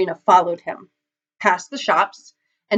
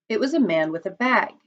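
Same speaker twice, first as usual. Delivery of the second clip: plain reading voice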